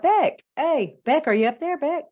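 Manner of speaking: retold call upstairs, loud and high; yelling out. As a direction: call out for someone in another room. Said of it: "So I yelled out".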